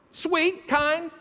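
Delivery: normal talking voice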